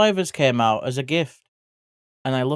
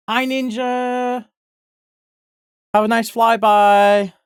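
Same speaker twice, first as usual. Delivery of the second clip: much higher, slow and stretched welcome, louder at the end; bright sing-song greeting; 10 semitones higher, 6 dB louder, slower than usual. Real, not acted. Streamer welcomes raider Ninja, high sing-song greeting. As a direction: sing the hello to a friend arriving.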